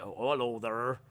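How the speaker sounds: low, quiet put-on Norfolk greeting; soft Norfolk farmer burr; Norfolk accent. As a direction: mimic a thick rural Norfolk accent.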